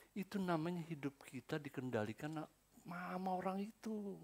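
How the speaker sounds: ordinary talk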